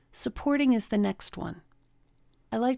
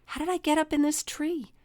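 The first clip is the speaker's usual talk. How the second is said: much higher, puzzled cartoon voice; confused Dumbo; 8 semitones higher than usual. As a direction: sound like a bewildered baby elephant. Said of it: "So Dumbo said".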